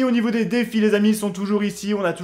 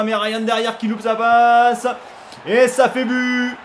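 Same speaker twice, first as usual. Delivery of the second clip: higher, slow, stretched-out commentary cry; alarmed goal call; 5 semitones higher, 4 dB louder, slower than usual. Real, not acted. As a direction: stretch the words as the mistake becomes a goal. Commentator calls a conceded goal.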